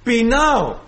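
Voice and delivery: whiny flesh voice. Acted. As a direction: beg like a hungry child. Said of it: "Σου λέει".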